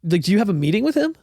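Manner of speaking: high, eager surprised question; perked-up surprise. Retold or imitated. Barista questioning the visitor, high surprised. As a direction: jump on it, curious. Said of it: "And Jordan was like".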